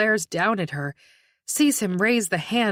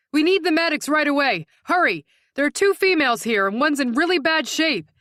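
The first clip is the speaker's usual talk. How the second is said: loud urgent shout; urgent fast call for medics; 7 semitones higher, 4 dB louder than usual. Acted. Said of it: "a female voice shouts".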